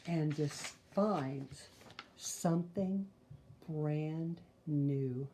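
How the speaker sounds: put-on lively, sing-song read-aloud for kids; storyteller voice for little listeners; reading to children. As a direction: read the rhymes like a bedtime picture book. Reads picture book to children.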